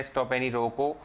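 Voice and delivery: usual speaking voice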